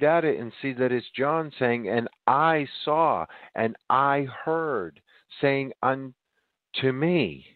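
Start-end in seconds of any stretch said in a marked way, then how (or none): 1.9-7.5 slow, drawn-out scripture quote; solemn scripture reading. Retold or imitated. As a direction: read each word of the verse slowly and deliberately. Quoting scripture from Revelation.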